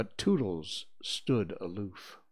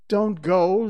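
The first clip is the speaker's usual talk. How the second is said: loud, higher pleading call; raised, pitying plea; 10 semitones higher, 11 dB louder than usual. A narrator's reading. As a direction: call out after him with pity. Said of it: "they called in pity".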